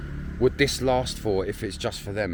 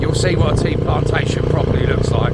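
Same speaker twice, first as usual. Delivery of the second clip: raised voice over engine noise; shouting over noise; 5 semitones higher, 6 dB louder than usual. Real, not acted. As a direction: talk up loud against the wind. Talking over road noise, riding.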